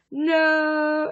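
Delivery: hammed-up plaintive refusal; mock wail. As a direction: imitate her distress with a long wail. Acted cry of distressed victim.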